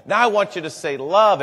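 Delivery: mother praising her daughter, delighted. Retold or imitated. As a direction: cheer her on like a proud mom.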